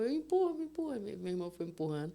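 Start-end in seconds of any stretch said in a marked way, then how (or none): 0.0-1.0 high, urgent brother voice; brother taking charge. Retold or imitated. Brother deciding to push the wheelchair in, high and urgent. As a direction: push through decisively. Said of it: "Aí, meu irmão".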